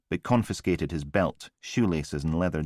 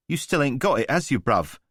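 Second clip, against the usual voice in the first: higher, taunting chav with a sneer; cocky street thug; 7 semitones higher, 4 dB louder than usual. A narrator's reading. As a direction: needle him like a smug geezer. Voice of Newman the thug.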